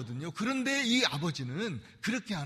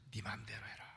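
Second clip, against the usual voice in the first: soft unvoiced whisper of God's permission; 12 dB quieter than usual. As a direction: breathe it out, no voice. God's word to Adam, whispered.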